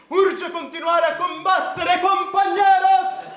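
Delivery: rising, loud rallying cry; rallying shout. Acted. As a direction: rouse the comrades, shouting. Rallying political speech.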